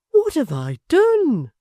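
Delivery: hushed dismayed plea; breathless helpless question; bewildered. A narrator's reading. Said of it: "said poor Gabriel".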